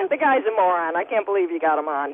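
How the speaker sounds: ordinary talk, nothing marked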